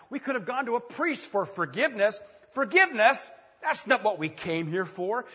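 loud, high outraged protest; indignant friends. Acted. The four friends protesting to Jesus, loud and indignant. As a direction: blurt out disbelief at "Forgiveness?".

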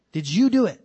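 loud, high, accusing question; stern loud question. Acted. God pressing Adam for a confession, stern loud question. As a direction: pin him down with it.

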